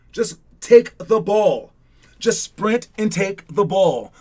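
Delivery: insistent coach emphasis; emphatic coaching. Real, not acted. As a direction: drive the advice home slowly. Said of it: "coaching advice emphasized".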